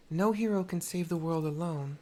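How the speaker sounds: player character line. Acted. Mercy tells the girl no hero works alone, voiced firmly. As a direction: say it like a firm hero's lesson. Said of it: "I think it's the better option".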